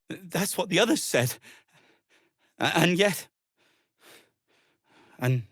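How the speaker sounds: stammering, choked-up questions; trailing off into grief, voice catching; grieving disbelief. Acted. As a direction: reach for hope that isn't there. Grieving friend's reported death.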